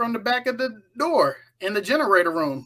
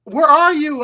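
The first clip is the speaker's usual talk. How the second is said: loud, high yell of where are you; retold shout, high and loud; 10 semitones higher, 9 dB louder than usual. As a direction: call out searching into the storm. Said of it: "she starts yelling".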